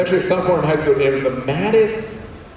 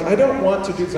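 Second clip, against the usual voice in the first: higher-pitched mock protest; retold protest, pitched up; reluctant protest; 5 semitones higher, faster than usual. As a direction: raise the pitch in mild pleading. Reluctant protest before punching wall.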